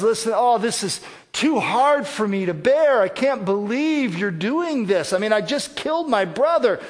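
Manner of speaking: high, loud whiny protest; making excuses. Acted. Cain pleading his excuses to God, high and whining. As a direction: whine like a guilty man caught out. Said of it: "He starts making excuses".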